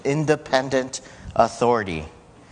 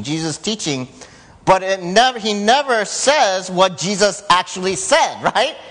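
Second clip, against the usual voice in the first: usual speaking voice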